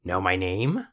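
croaky little-man challenge; croaked. A narrator's reading. Little man testing the girl, croaked. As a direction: croak it out as a taunting question.

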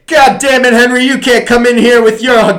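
high, loud hammed-up principal yelling back; mock angry principal. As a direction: shout it like a fed-up movie boss. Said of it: "the principal's like".

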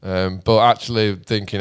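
ordinary talk, nothing marked

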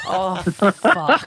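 loud oath broken by laughter; laughing repeat. Retold or imitated. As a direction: laugh through the curse. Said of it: "And he just goes".

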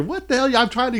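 high-pitched mock protest; exasperated, high, without extra volume; retold exasperated outburst. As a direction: act out being fed up, pitch jumping up.

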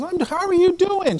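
friendly greeting. Retold or imitated. Retelling his chat with her at college, friendly greeting. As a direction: greet in a high imitated voice.

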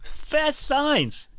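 hammed-up ad read of the name; echoing the sponsor. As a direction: echo it like a cheesy jingle. Cohost echoes sponsor name.

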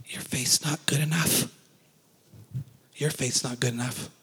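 unvoiced, hushed whisper; devil's whisper. Shown as a demonstration. Preacher whispering the devil's lie to the church. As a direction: breathe it like a voice in your ear. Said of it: "'cause I whispered it".

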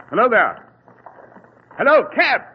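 loud, high call across the street. Acted. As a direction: hail the cab through the fog, full voice. Hailing a cab in fog.

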